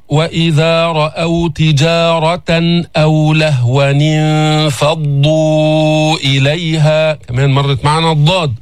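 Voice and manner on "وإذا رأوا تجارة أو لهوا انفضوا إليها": slow chanted Quran recitation. Acted. Teacher recites the verse for the class, chanted slowly. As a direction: intone the verse slowly, tajwid style.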